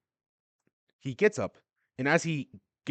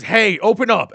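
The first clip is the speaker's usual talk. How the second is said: high, loud shout at the shut door; shouting to be let out; 11 semitones higher, 14 dB louder than usual. Acted. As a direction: bang and yell at the door. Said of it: "Donji says".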